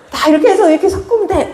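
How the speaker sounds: fast, high-pitched and breaking into laughter; laughing imitation. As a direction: rattle off his excuse through giggles. Repeats cook's excuse while laughing.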